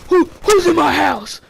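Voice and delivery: loud, slow, high-pitched holler; menacing shout. Retold or imitated. Chainsaw man challenging intruders, loud slow shout. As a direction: bellow it like a scary haunt character.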